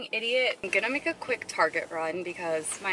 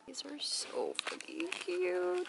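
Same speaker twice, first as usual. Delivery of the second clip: slow, high whisper over something cute; 10 semitones higher, 8 dB quieter, much slower than usual. Real, not acted. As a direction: whisper it slowly, almost squealing under your breath. Whispering over cute items in store.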